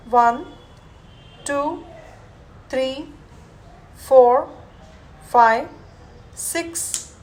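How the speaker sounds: firm counting cues; guided yoga count. Acted. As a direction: call out the count steadily. Yoga teacher guiding a held breath.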